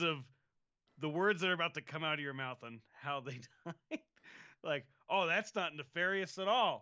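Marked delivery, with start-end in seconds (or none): 5.1-6.8 high, loud sarcastic quip; sarcastically dismissive, pitched up; mock innocence. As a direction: scoff it with exaggerated innocence. Sarcastic quote about suspicious death.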